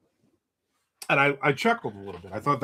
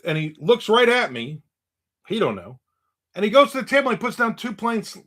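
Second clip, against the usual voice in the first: ordinary delivery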